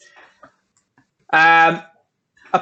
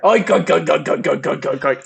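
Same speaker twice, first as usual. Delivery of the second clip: pig noises. Retold or imitated. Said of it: "she goes".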